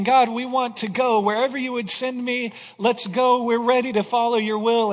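imitating a zealous worshipper's pledge; crying out in prayer. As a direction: voice the people promising God to go anywhere. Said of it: "They're crying out and saying".

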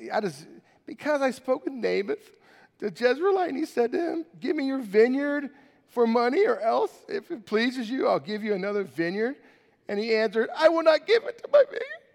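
high, pleading whine of a sulking king; sulking king whining to his wife, high and nasal-free whine; whiny king. Acted. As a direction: whine it like a spoiled child king. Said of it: "Do you hear that in a whiny voice?".

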